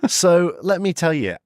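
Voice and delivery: slow, drawn-out scenario setting; deliberately slow. Real, not acted. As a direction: stretch out each phrase for the listener. Slows down after speed joke.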